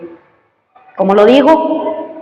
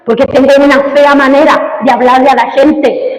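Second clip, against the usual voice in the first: raised, driving pulpit voice; fiery preaching; 6 semitones higher, 3 dB louder than usual. Acted. Pastor urges his congregation to compassion, fiery preaching. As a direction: preach it hard and pushing.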